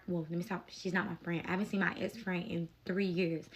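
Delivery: ordinary talk, nothing marked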